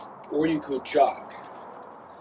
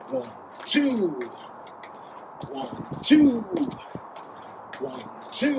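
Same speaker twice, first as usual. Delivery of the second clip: counts called out much higher; high, bouncy counting for the lift; 11 semitones higher, much slower than usual. Shown as a demonstration. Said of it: "If I give it a lift".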